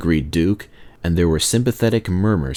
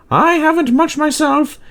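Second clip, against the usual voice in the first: much higher, light lady's voice; shop lady; 3 dB louder than usual. A narrator's reading. Shop lady greeting the engine crew, high-pitched. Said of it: "The shop lady met them".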